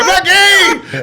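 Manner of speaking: a high, slow mock shout; the father's drawn-out outraged protest; angry denial. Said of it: "Like, angrily".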